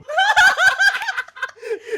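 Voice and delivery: big shouted burst of laughter; loud laugh. Real, not acted. Laughs at failing result.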